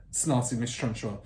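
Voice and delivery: ordinary delivery, nothing marked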